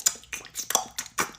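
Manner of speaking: voiceless mouth noises mimicking ASMR; imitated weird noises. Dad describes noises from the bedroom, imitated weird noises. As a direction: make odd little wet mouth sounds. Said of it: "They sounded like".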